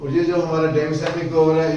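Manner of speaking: ordinary talk, nothing marked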